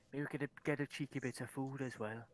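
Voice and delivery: low, softened aside to a pal; conspiratorial whisper. Acted. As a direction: murmur it slyly into his ear. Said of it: "I'll whisper in his ear".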